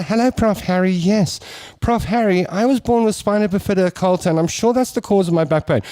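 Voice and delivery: a hint of a chatty caller; imitating mother on radio.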